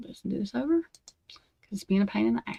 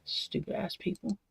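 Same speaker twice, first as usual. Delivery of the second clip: muttered insult; 12 dB quieter than usual. Real, not acted. Angry rant, muttered aside.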